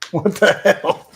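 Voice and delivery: high, slow, strained exasperation; drawn-out disbelief at a stuck lid; exasperated. Real, not acted. Struggling to open iced tea lid.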